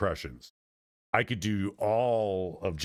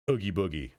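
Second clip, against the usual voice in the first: ordinary talk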